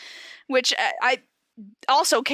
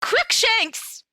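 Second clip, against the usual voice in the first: shouted name; 9 semitones higher than usual. Acted. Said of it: "I shouted at him".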